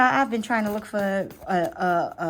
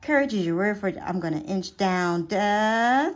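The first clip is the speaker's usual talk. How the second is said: normal talking voice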